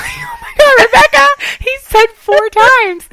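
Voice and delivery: high-pitched, loud mock wail; exasperated outburst. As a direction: cry out in hammed-up disbelief. Exasperated reaction to friend's stubbornness.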